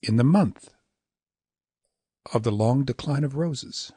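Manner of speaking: "In the" quick, "month" stressed and held; "In the month of the long decline of roses" in spoken rhythm; two shorts. Shown as a demonstration. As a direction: skip lightly onto month, then recite on. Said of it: "substituted those two longs with two shorts".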